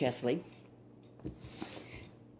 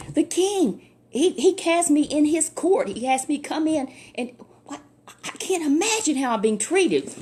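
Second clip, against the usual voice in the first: indignant general; 7 semitones higher, 4 dB louder than usual. Acted. Offended general complains.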